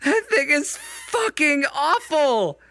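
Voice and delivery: high, loud terrified yelling with a laugh; frightened shriek. Real, not acted. Streamer shrieking in fright at the creature, high and loud. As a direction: shriek in real fright at the monster.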